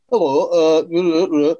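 bad mock Sunderland accent; deranged attempt at northern English. Shown as a demonstration. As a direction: gabble like a southerner faking northern. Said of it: "trying to do a northern accent".

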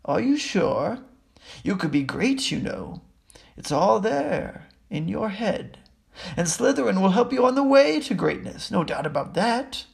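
high, lilting little voice; small voice. A narrator's reading. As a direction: coax in a small, swooping voice. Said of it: "said the small voice".

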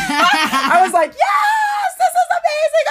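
delighted laughing squeal; laughing shout. Real, not acted. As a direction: burst out laughing, voice jumping up. Laughing at the fake voice.

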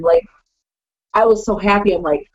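ordinary talk, nothing marked

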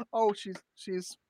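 normal talking voice

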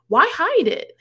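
high, loud, incredulous question; baffled exclamation. Retold or imitated. Host questioning the secret hookup, loudly baffled. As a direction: jump up in pitch and demand an explanation.

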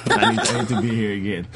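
normal talking voice

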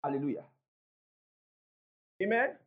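usual speaking voice

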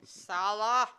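mock annoyed shout; annoyed call. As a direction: shout his name out, irritated. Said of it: "in an annoyed voice".

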